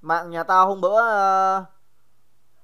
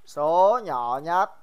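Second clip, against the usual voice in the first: slow, drawn out; 3 semitones lower, much slower than usual. Shown as a demonstration. Teacher drawing out the question slowly. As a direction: stretch each word slowly. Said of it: "repeats question slowly".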